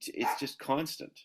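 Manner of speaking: ordinary talk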